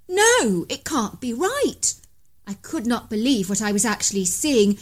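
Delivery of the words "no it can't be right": disbelief. A narrator's reading. Seeing a Bigfoot.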